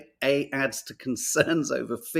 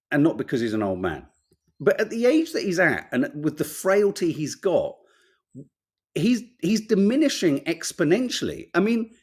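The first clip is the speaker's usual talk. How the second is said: usual speaking voice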